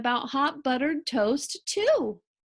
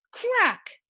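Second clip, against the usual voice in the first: cracking sound effect; 10 semitones higher, 4 dB louder than usual. A narrator's reading.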